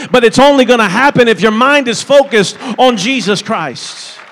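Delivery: loud, high-pitched preacher's exhortation; full-force preaching. Real, not acted. Preacher urging the congregation to focus on Jesus, full-force preaching.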